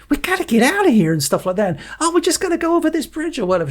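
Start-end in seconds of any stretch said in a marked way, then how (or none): 0.1-1.1 put-on high childlike voice; imitating a young girl; little girl voice. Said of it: "going like". 2.0-3.4 put-on very high child's voice; imitating a little girl commentating. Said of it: "She's talking".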